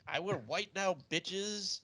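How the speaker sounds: hammed-up triumphant boast; mock dramatic. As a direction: announce it like a diva reveal. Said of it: "He's like".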